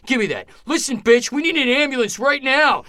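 imitated high, loud furious demand; angry yelling. Psychopath grabbing the phone from caller, yelling at the dispatcher. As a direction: build from a curt order to a yell. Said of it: "he starts yelling at her".

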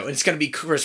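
usual speaking voice

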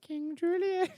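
high cartoon lemur voice; King Julien. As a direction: announce yourself like a lemur king.

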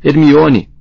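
loud high desperate "Hermione!"; roar. A narrator's reading. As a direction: bellow her name in panic. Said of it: "urrou Rony". (Rony berrando o nome de Hermione)

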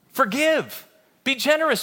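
ordinary delivery

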